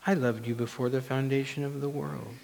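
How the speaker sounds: very soft, tender answer; God's voice. Acted. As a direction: answer the unloved self in a hushed voice. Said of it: "And God's voice says".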